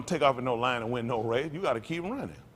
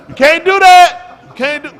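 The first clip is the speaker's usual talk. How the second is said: much louder, much higher, slow shouted protest; kids yelling protest; 14 semitones higher, 20 dB louder, slower than usual. Retold or imitated. Playmates protesting the rule, much louder, higher slow shout. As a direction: yell it like an outraged kid. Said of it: "they go".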